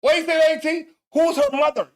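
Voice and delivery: loud, high disbelief; incredulous shout. Real, not acted. Talking back to the video, incredulous. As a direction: shout like you can't believe it.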